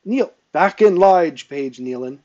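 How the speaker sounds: loud, high-pitched command; barked order. A narrator's reading. Sergeant to page who left the line, barked order. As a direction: order the page back sharply. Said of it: "Ezekiel ordered as he came over".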